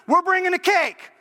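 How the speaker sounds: high call to someone in the back; acted high-pitched shout across the diner. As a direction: holler to your wife in another room. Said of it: "He calls to his wife who's in the back".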